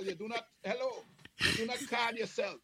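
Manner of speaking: ordinary delivery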